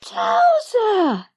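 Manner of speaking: high, loud yell of joy. Acted. Lizzie spots the old dog at the door, a high, loud, joyful yell. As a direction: shout the dog's name in delight. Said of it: "yelled Lizzie".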